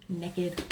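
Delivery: "Naked" as a low, soft "nekkid"; her own pronunciation. Shown as a demonstration. Mother repeating her way to herself, softly "nekkid". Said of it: "I say naked".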